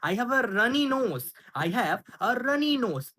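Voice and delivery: very slow, clear teacher read; slow model phrase. Shown as a demonstration. Teacher models the English phrase, very slowly. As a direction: spell it out slowly for learners. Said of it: "তাহলে কি হবে".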